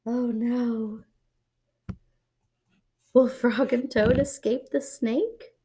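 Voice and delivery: plain reading voice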